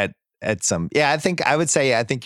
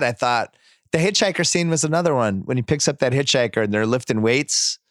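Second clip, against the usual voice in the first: ordinary delivery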